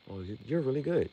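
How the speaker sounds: high, quick, hammed-up compliment; mocking fighter praise. Mocking the anime fighter praising his rival, high and fast. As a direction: parody the hollow flattery. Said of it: "like, that's how it feels".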